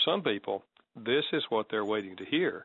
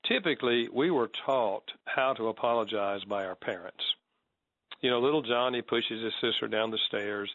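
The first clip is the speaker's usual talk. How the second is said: normal talking voice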